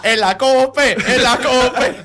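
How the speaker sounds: shouted quote. Retold or imitated. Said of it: "Y otro grita".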